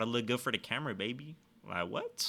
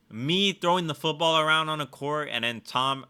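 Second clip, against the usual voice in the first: ordinary delivery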